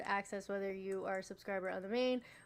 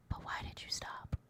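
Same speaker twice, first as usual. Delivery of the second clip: teasing whisper; 5 dB quieter than usual. Pretend. Whispered chiding of viewers.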